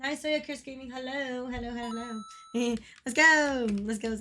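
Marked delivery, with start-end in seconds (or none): none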